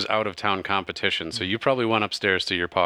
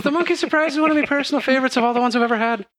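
high, quick showy female voice; bright lilting gambler voice, raised high; Kalora speaking; 4 dB louder, much faster than usual. Acted. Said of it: "She says".

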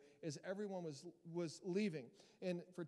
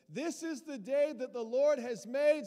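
lifted, loud hallelujah-style declaration; loud proclaiming; 6 semitones higher, 12 dB louder than usual. Acted. Preacher quoting a psalm to the congregation, loud and ringing. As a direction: call it out to the church.

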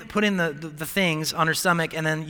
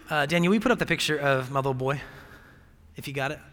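normal talking voice

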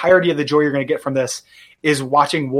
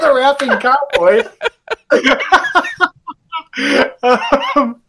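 laughing; 9 semitones higher, much slower than usual. Real, not acted. Repeating the joke, laughing.

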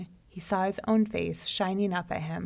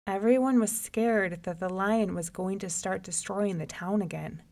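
plain reading voice

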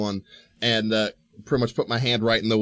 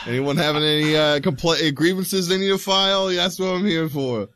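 Tony the Union Wizard; 6 semitones higher than usual. Acted. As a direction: play a chummy union wizard greeting the members. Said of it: "Tony the Union Wizard".

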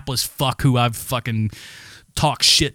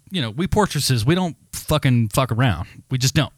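normal talking voice

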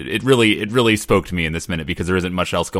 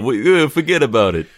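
louder mock Italian mafia accent; hammed-up Italian wiseguy; Brian De Palma; 5 semitones higher, 4 dB louder than usual. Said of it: "Like Mafia".

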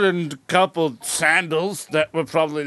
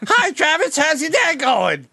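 mock Turkish accent; 14 semitones higher than usual. Imitating a Turkish coworker's greeting in a mock Turkish accent. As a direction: chirp it in an overdone Turkish accent. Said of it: "She sounded like that".

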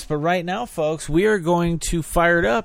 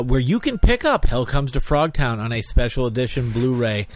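normal talking voice

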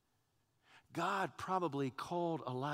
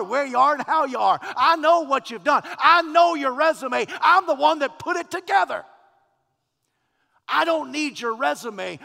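louder, higher preaching build; 8 semitones higher, 15 dB louder than usual. Acted. God answering Elijah through the preacher, forceful preaching.

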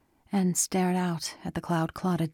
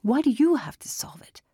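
insistent, higher-pitched questioning; pressing; 8 semitones higher, 3 dB louder than usual. A narrator's reading. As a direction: press for an answer, pitch lifted. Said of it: "Chris pressed".

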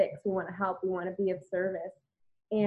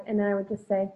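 normal talking voice

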